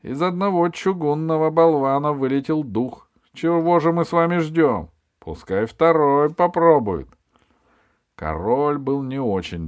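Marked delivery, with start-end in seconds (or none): 0.0-7.1 high, loud voice to the king; loud address. A narrator's reading. Jester loudly urges the king to go on, loud and high. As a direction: speak up forcefully for all to hear. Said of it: "Он громко сказал королю".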